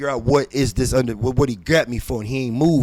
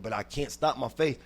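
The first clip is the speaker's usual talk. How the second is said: normal talking voice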